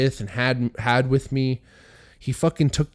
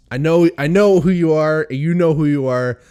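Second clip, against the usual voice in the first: loud; 6 semitones higher, 9 dB louder than usual. Real, not acted. Calling out his buddies.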